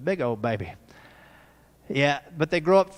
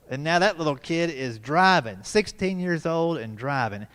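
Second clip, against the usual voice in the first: ordinary talk, nothing marked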